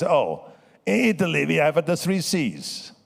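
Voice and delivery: mock Italian mentor listing the three C's; Italian accent. Producer explains the secret to success in Italian accent. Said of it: "So he would talk like that".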